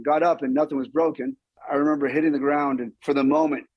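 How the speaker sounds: ordinary talk, nothing marked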